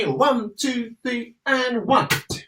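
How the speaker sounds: calling out the beats; calling the count. Shown as a demonstration. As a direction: call the count to the class. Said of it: "Are you ready?".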